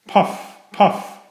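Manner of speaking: short, airy puffs, spaced apart; out-of-breath engine puffing; panting. A narrator's reading. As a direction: puff each word out on a breath. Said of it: "panted poor Thomas".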